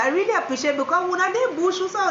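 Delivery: high, loud burst of delight to the grandson; excited greeting. Real, not acted. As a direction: greet him with a happy lift.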